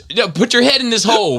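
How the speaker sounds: high mocking call of a crowd; mock executioner voice. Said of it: "They'd be like".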